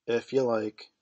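understated, offhand consent; indifferent. A narrator's reading. Peter answers Wendy's request about her brothers, indifferent. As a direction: answer as if you hardly care. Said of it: "he said indifferently".

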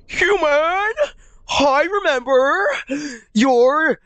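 high, slow theatrical character; hammed-up high game-character voice; trial character voice. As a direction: declaim it high and drawn out. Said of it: "Let me try something".

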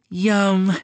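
high, pleased yum; delighted yum. Acted. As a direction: savour it with delight. Tasting fried treat.

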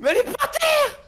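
high exasperated yell; shouting. Real, not acted. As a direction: yell it at your partner.

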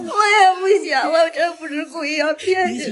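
en pleurs. Real, not acted. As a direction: excuse sanglotée, plus forte. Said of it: "你先别哭，".

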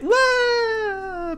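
high, thin fading wail; creature's falling cry. Acted. Game master voices the troll falling into the pit, high falling cry. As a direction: wail like a monster dropping away. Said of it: "you hear the roar of the creature as it just falls".

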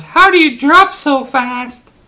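high, slow mocking viewer voice; mock commenter voice. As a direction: squeak it out like a whiny commenter. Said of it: "before we see a bunch of comments".

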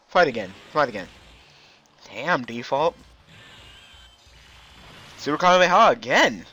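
normal talking voice

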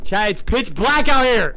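high, loud, slow alarmed call; scared shout. Real, not acted. Frightened streamer yelling to chat, loud. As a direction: yell it into the dark.

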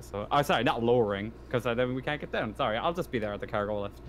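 normal talking voice

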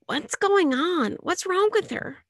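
high, slow startled questions; groggy and alarmed; alarmed brother. Acted. Brother stumbling in confused.

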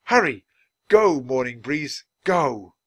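loud, urgent urging; shout. A narrator's reading. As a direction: call out to spur on the elephant. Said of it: "His voice rose to a shout".

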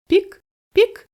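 very high, tiny peep; chick's peep. A narrator's reading. Newly hatched chick calling out, very high peeps. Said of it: "почули пташки".

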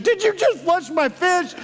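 imitating a freaked-out daughter, high-pitched; mock freaking out. Daughter to dad after he flushes her fish, freaking out. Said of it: "like, freaking out".

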